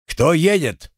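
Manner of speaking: a sentry calling out into the dark; shouted challenge. A narrator's reading. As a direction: call out sharply, demanding. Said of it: "окрик от ветряка".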